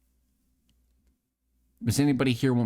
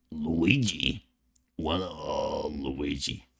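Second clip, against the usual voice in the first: low, throaty character voice; Mario; 6 semitones lower, 4 dB quieter, much slower than usual. Pretend. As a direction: rumble it low in the throat, quietly. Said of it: "the Mario voice?".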